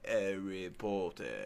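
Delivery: put-on slow British accent with dropped H; British accent, imitated. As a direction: draw it out like a Brit. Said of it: "other people like".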